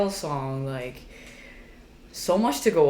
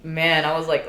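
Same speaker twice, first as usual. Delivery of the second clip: normal talking voice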